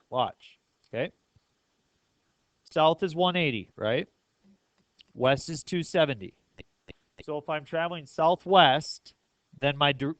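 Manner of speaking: ordinary talk, nothing marked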